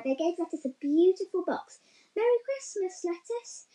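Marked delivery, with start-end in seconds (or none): 2.1-3.7 cheerful call. A narrator's reading. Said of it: "they cried".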